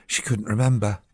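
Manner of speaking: usual speaking voice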